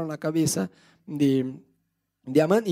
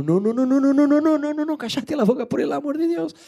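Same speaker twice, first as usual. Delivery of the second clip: panicked denial; 8 semitones higher than usual. Acted. Amán caught out, frantically silencing the accuser. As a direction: beg him to stop, panicking more each time. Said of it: "me imagino la cara de Amán como".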